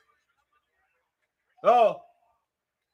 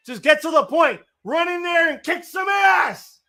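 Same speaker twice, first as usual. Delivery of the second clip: urging on; 9 semitones higher, 5 dB louder than usual. Real, not acted. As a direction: drive him into the ring with your voice. Urging wrestler on screen.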